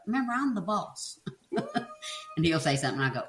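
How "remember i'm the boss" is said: high, teasing reminder of who's in charge; playful bossiness. Retold or imitated. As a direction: pull rank in fun, pitched up. Playfully asserts authority over husband.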